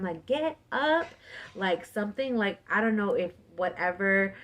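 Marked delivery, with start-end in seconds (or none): 0.2-1.1 high-pitched mock scream, held back in volume; retold yell. Woman's last yell before she breaks loose, retold yell. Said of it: "when I yelled that last time".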